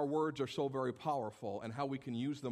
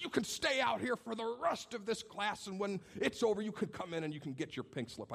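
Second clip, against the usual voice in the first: imitated teacher rant; 8 semitones higher than usual. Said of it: "screaming at me for my disrespect".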